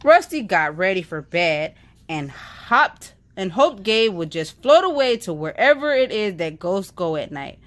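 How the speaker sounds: usual speaking voice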